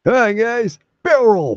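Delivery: high, bright host greeting; mock show host. Pretending to host a gaming show with a high, cheery greeting. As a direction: pop in as a peppy show host.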